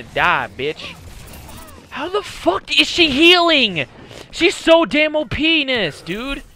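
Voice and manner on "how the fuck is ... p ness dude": can't believe she's healing; frustrated gamer disbelief; incredulous. Real, not acted.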